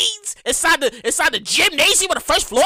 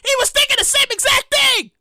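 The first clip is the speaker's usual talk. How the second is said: excited vindication; 10 semitones higher than usual. Real, not acted.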